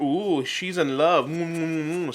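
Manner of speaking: slow singsong tease; teasing little brother. Retold or imitated. Little brother teasing his sister, slow mocking singsong. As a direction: drag it out to mock her crush. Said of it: "making fun of her".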